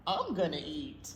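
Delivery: usual speaking voice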